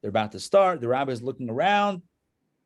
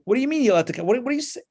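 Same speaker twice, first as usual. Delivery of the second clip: baffled question; 8 semitones higher, 4 dB louder, much faster than usual. Acted. Assistants baffled by cat.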